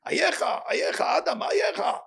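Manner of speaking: high calling shout of Ayecha; divine call. Acted. God calls out to Adam in the garden with a high shout. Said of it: "when Hashem say".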